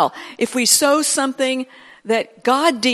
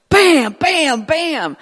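louder, higher punchy bams; emphatic bam; 5 semitones higher, 3 dB louder, much slower than usual. Retold or imitated. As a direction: hit each bam hard. Acted declaration at the cross.

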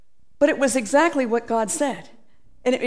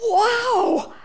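amazed wow; 10 semitones higher than usual. Acted. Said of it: "The Lord is just like".

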